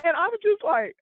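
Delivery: ordinary delivery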